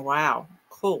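normal talking voice

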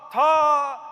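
ordinary delivery

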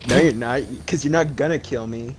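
normal talking voice

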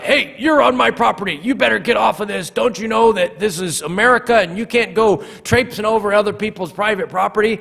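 high, indignant mock rant; making a big stink. Pastor imagining himself scolding neighbors on his property, mock-indignant. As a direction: play an outraged property owner telling off trespassers. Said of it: "if I had made a big stink about it".

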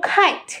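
"খাইট" with a puffed kh; correct kite. Shown as a demonstration. Teacher models correct kite, aspirated "খাইট". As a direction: burst air into the start of "খাইট". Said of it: "বলবো".

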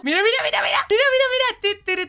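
mira, mira, mira, cada vez más agudo y fuerte; llamada excitada. Real, not acted. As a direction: llamar para que miren, subiendo y subiendo. Llama la atención en el juego. (llamada cada vez más aguda y fuerte)

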